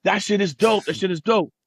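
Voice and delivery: high, excited praise; impressed listeners. Retold or imitated. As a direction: imitate someone blown away by what they heard. Acts label men's thrilled reaction.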